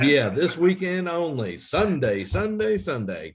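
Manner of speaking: high, loud radio-ad hype, Sunday soaring; car salesman. Acted. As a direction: shout the sale like a monster-truck ad. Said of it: "a car salesman".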